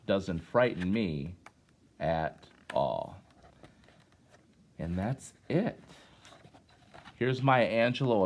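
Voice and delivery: usual speaking voice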